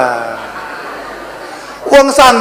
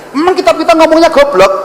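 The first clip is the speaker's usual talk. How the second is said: higher take; 10 semitones higher than usual. Shown as a demonstration. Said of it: "repeated slower".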